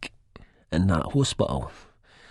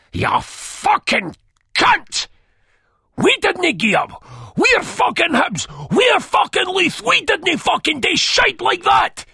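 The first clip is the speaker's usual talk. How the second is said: roaring much higher and louder, enraged; furious shout; 6 dB louder than usual. A narrator's reading. Begbie raging at Spud over his betrayal, furious bellow. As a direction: bellow it with wounded pride.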